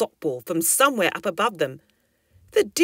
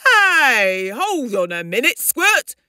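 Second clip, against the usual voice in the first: put-on raised, higher call to a cheeky child; remonstrating dad; 6 semitones higher, 5 dB louder than usual. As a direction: pull the youngster up short, in character. Dad checks the cross baby.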